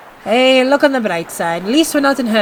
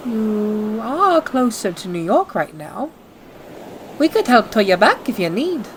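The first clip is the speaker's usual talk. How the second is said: ordinary talk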